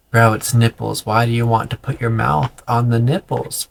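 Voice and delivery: ordinary talk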